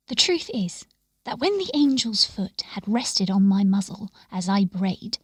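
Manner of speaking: ordinary delivery, nothing marked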